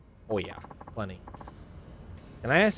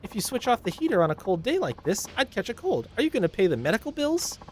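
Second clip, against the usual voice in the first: higher, scolding complaint; nagging Karen; 12 semitones higher than usual. Acted. Karen passenger scolding the taxi driver, higher nagging voice. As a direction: nag the driver for being late. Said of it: "complains about everything".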